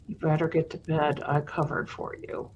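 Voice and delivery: hushed, secretive mom whisper. Retold or imitated. Mom covering for her daughter, whispering. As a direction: whisper it like a conspiring mother.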